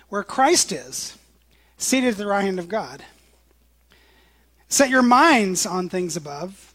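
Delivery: slow, solemn Bible reading; scripture reading. Acted. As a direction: read the verses with weight. Reads scripture aloud.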